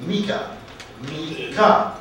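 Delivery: "Mecca" said as MEE-kah, punching MEE; deliberate MEE-kah for "Mecca", teaching the stress; first syllable stressed. Shown as a demonstration. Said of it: "The first syllable is stressed".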